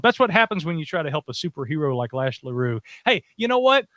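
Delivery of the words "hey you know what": mock bravado. To the other hosts, a hammed-up tough boast. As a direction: puff up and warn them off.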